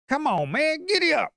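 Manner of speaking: high, loud giddy-up yelling at the chart; frantic cheering. Real, not acted. Trader yelling at the price chart to climb, frantic cheer. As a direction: whip the price along like a jockey.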